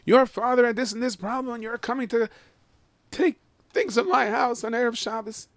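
high-pitched mock screaming in protest; indignant screaming. Non-giver confronting charity collectors at the door, high indignant screaming. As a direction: imitate an outraged householder screaming way up high. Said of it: "could start screaming".

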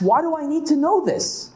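high, exasperated challenge; Rava's indignant question. Acted. Teacher voicing Rava's challenge, high and exasperated. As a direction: raise the pitch and push back on the text. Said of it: "Rava asks".

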